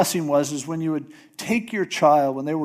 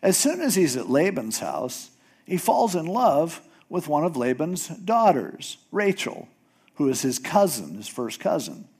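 normal talking voice

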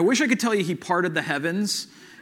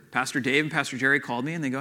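normal talking voice

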